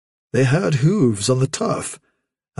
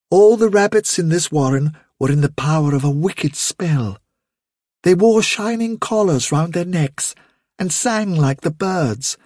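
higher-pitched mother's storytelling voice; lilting, higher storyteller; mother rabbit storytelling; 6 semitones higher than usual. Acted. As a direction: tell a tale to little ones in a higher voice. Vilthuril tells a story.

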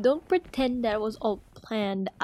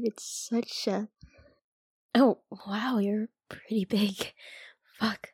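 whispering. Acted. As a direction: whisper it close to the ear. Intimate whisper.

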